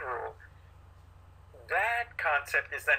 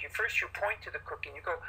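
ordinary delivery

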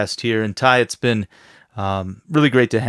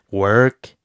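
"work" leaned on with a rising end; emphasis on work; 4 dB louder than usual. Shown as a demonstration. Trainer showing how he'd ask God, "work" stressed. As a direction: punch the word "work" as a challenge. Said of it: "I'd ask it like that with emphasis".

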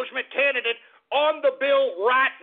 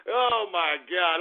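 normal talking voice

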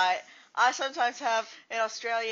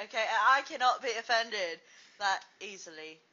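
ordinary talk

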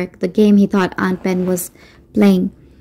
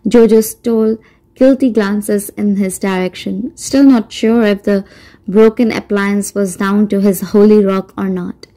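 usual speaking voice

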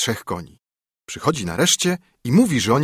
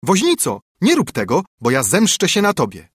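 high, louder call to the carter; sparrow calling out; 6 semitones higher, 4 dB louder than usual. A narrator's reading. Sparrow warns the carter not to run down the dog, calling loudly. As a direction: shout a warning up at him. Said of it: "woła".

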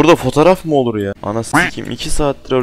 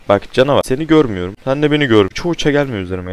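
ordinary talk

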